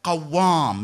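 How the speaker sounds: Qawwam said with a stressed doubled w, falling; teaching the Arabic word Qawwam, each sound clear; Arabic term Qawwam. Shown as a demonstration. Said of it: "What would you call that person?".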